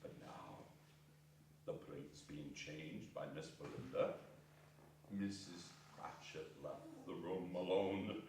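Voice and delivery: breathless whisper of suspense; hushed suspense. A narrator's reading. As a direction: draw them in with a hushed voice. Suspense before the pudding.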